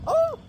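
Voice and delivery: very high imitated female moan; mock moan. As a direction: squeal out a short mock moan.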